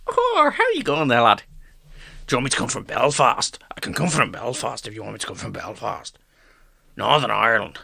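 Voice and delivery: put-on Belfast accent; playful Northern Irish impression. Shown as a demonstration. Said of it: "You can do Irish".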